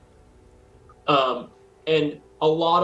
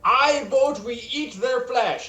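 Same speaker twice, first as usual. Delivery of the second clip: high, put-on zealot voice; eager cannibal cultist; 8 semitones higher than usual. Gary voting to eat the visitors, high and eager. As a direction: pipe up keenly in a high character voice.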